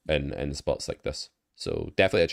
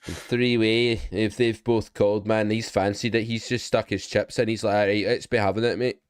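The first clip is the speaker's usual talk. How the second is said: higher-pitched, put-on lad banter; childish mock voice; 5 semitones higher, 3 dB louder than usual. Coach acting out a cocky player's move, childish mock voice. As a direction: ham it up as a silly, high-voiced lad. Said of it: "Sorry, I become a child".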